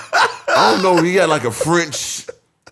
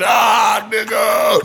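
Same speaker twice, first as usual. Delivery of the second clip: mock yell; 8 semitones higher, much slower than usual. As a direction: holler it while running away. Retold friend running off yelling.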